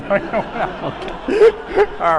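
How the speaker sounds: loud real burst of laughter. Real, not acted. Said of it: "laughs at son's line".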